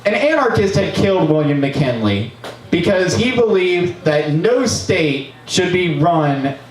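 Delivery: normal talking voice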